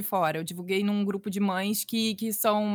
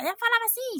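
much higher-pitched mock mother voice; imitating her mother; 13 semitones higher than usual. Daughter imitates her mother, pitched high. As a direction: pitch it way up to mimic mom. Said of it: "eu imitava ela, fazia".

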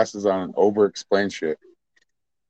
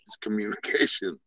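whispering; 4 semitones lower, 9 dB quieter than usual. Real, not acted. Hushed aside while hurt.

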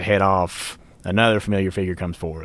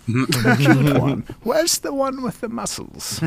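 put-on high, giggly old lady; laughing old woman; 7 semitones higher, 4 dB louder, slower than usual. GM as Opoly, amused.

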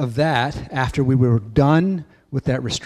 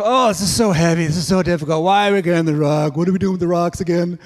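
higher, louder whine; whiny complaining kids; 4 semitones higher, 5 dB louder than usual. Retold or imitated. Tired kids complaining about heavy rocks, whiny. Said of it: "Lots of complaining".

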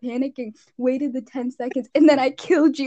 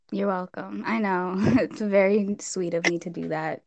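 usual speaking voice

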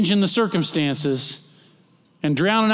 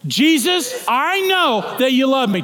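proclaiming aloud; 9 semitones higher, 4 dB louder, slower than usual. Shown as a demonstration.

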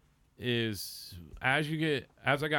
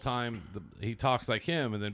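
ordinary talk

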